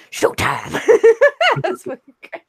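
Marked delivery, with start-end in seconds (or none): 0.1-0.7 low raspy showtime; Beetlejuice voice. Retold or imitated. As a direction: drop low and rasp it. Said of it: "if you say showtime during".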